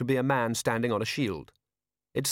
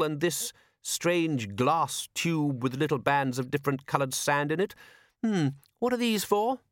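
slow, higher-pitched itemising; sceptical lord; 6 semitones higher than usual. A narrator's reading. Patrician goes through the sergeant's souvenirs, sceptical. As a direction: list them deliberately, in polite bewilderment.

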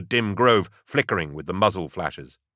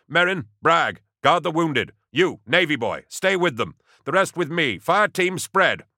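commanding orders; 9 semitones higher than usual. Acted. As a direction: snap out orders to the squad. Commissar barks orders to troops.

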